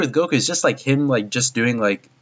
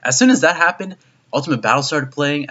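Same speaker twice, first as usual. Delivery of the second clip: ordinary talk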